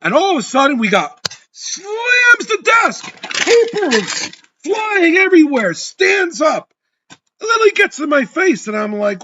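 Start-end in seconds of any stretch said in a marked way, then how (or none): 1.5-8.7 high, loud, rising as the story hits the outburst; narrating the blow-up almost shouting; retold outburst. Telling a friend the broker lost it, loud and high.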